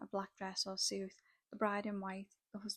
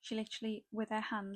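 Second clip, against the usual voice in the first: ordinary talk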